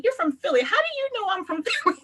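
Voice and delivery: high-pitched, amused back-and-forth quote; playful, high mock astonishment; mock surprise. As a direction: act out an incredulous exchange, laughter creeping in. Acts out a startled accent exchange.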